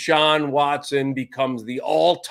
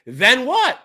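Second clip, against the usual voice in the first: ordinary talk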